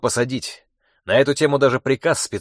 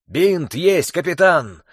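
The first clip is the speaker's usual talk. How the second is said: wounded man's raised cry; man down shout; 5 semitones higher, slower than usual. A narrator's reading. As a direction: call out for a bandage. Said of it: "крикнул раненый".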